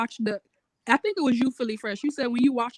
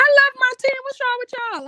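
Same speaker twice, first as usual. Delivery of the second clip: much higher and louder, protesting; indignant outburst; 13 semitones higher, 7 dB louder than usual. Real, not acted. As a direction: fire back in defense of the team. Defending her team, indignant.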